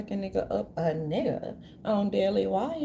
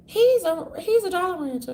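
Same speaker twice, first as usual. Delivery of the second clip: high, louder giddy gush; a hammed-up swoon, pitched up; giddy mock fan voice, higher; 8 semitones higher, 5 dB louder than usual. Gushing repeat.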